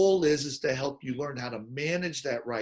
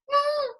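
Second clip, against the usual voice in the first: tiny high whimpering noise; a squeaky hurt little mm; imitating a grown man's hurt whimper. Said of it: "He'll make this little noise".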